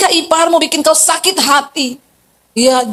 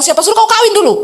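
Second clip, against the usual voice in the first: sharp retort; 7 semitones higher, faster than usual. Retold or imitated. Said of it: "saya bilang".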